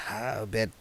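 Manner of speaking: hushed, breathy aside as if embarrassed to say it; whispered aside. Acted. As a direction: drop to a whisper mid-sentence. Awkward hushed aside in dialogue.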